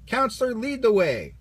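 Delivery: Woody cheering on the camp counselor; excited cry. A narrator's reading. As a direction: cheer the leader on. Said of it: "cried Woody".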